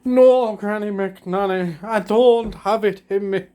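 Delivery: high, slow put-on mopey voice; a high slow fake sulk; fake sad denial. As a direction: whine it slowly, pretending to be sad. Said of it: "I was like".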